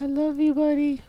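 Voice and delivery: put-on low, soft drunken mumble; drunk affection. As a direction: slur it fondly, half asleep. Drunk gnome declares love.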